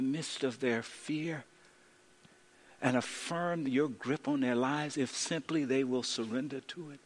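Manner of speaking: soft, low pastoral prayer voice; closing prayer. Acted. Pastor praying over the congregation, soft and low. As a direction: pray gently over the listeners.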